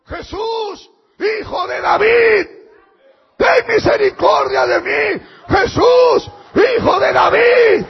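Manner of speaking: loud, high, pleading shout; desperate cry. Acted. A beggar calling out to Jesus for mercy, a loud desperate cry. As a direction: cry out for mercy with everything you have.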